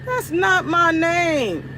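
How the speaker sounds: "That's" stressed, every word stretched slow; slow drawn-out protest. Shown as a demonstration. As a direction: drag out "That's not my name" slowly. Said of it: "repeats the complaint".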